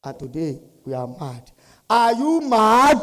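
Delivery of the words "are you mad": loud, high, drawn-out question; rousing call-out. Real, not acted. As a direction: stretch it out and fire up the crowd. Calling out to rouse the audience.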